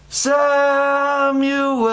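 high, long-drawn call. Acted. God calls to sleeping Samuel in the night, long high call. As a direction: stretch the name out on a high, held note. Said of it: "He hears it again".

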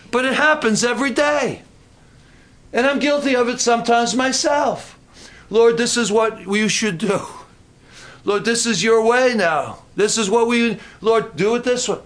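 high, worked-up preaching; yelling. Real, not acted. Preacher confessing he gives the Lord instructions, yelling. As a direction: hammer it home, pitched high. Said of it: "Sorry for yelling".

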